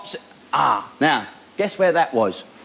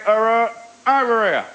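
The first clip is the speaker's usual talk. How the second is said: a slower, higher put-on heckler call; slow mock heckle; 4 semitones higher, slower than usual. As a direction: drag out the over here bit. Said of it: "I'll say".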